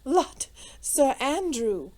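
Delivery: lightly amused lady calling to a friend; on the edge of laughter; merry. A narrator's reading. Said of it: "with one of her merry, infectious laughs".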